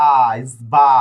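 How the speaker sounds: ইসবা said clearly and loudly with a lift at the end; sounded-out word. Shown as a demonstration. Teacher modelling a Spanish word for the class, loud and clear. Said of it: "এখানে এই যে স্কুলের উচ্চারণ যেটা".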